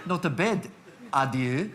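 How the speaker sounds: amused attempt at a South African accent. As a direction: try the line in South African. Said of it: "he's made it South African".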